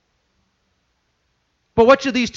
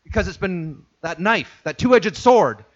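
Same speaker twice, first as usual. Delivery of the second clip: normal talking voice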